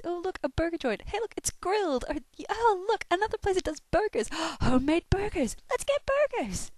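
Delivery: gasping with burger delight; excited burger craving. Retold or imitated. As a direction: squeal at every burger joint. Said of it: "Every time we s".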